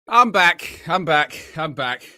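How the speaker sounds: loud high announcement, settling down; loud to plain, building down; excited return. Real, not acted. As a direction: burst back in then calm down. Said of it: "announcing return, fading".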